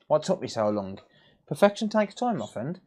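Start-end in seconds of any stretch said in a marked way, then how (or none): 1.5-2.8 higher, smug "Perfection takes time"; mock-grand smug line. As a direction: sound grandly self-satisfied. Smug reply.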